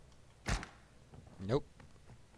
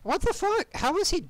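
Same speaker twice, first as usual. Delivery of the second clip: much higher, louder disbelief; shocked yelp; 4 dB louder than usual. Real, not acted. Gamer shocked by a sudden death, high loud yelp. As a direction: cry out, caught off guard.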